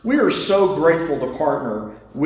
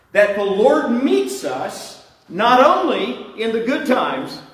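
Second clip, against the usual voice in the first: preaching; 4 semitones higher than usual. Acted. Preaching, slow and lifted.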